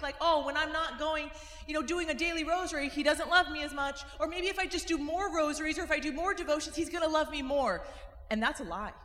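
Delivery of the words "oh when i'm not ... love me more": higher, fretful voice of someone who thinks love must be earned; mock worried believer. Speaker mimics her listeners' inner worry, in a higher fretful voice. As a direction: parody the anxious thought, pitched up. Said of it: "but you and I sometimes think".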